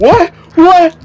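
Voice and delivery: very high, loud yelp; acted alarmed shout. Game master voices cultist facing Mott, alarmed yelp.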